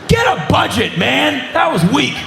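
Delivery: high-pitched jeer at the stage; mock heckle. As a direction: scoff like a disappointed fan. Heckles the feeble special effect.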